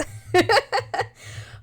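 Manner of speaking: high giggle after the impression; laughing. Real, not acted.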